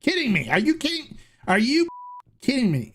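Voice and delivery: mock-exclaim it like you can't believe it; excited disbelief. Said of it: "I go".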